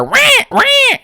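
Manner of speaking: very high squawking parrot. Retold or imitated. Squawking fans like parrots, very high.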